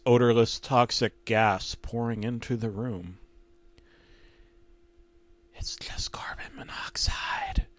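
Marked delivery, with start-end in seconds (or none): 5.6-7.7 very high unvoiced ghostly whisper; eerie voice. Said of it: "a voice says, but it doesn't sound like any voice actor".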